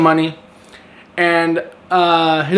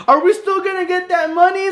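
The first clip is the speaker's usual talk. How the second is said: much higher, put-on whiny voice; whiny friend; 12 semitones higher than usual. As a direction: mimic the greedy, annoying sidekick. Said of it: "he's like".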